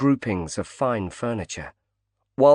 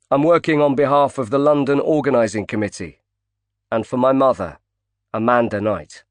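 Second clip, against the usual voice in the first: calling across a busy bar, louder; raised voice; 3 semitones higher, 4 dB louder than usual. Acted. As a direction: project to the man at the bar. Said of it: "said loud enough for Gilder to hear".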